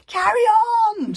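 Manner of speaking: high, loud, slow shriek. A narrator's reading. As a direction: shriek it out desperately. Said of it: "shrieked Alvin".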